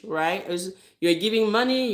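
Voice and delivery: ordinary talk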